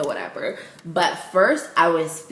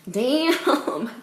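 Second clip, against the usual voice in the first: higher, drawn-out exclamation; emphatic amazement; 6 semitones higher than usual. Retold or imitated. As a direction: stretch it out, really impressed. Repeated bigger damn.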